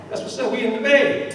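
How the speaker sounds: high-pitched mock celebration; lightly acted delight, voice jumping up; excited crab. Crab celebrates reading the sign.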